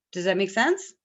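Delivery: usual speaking voice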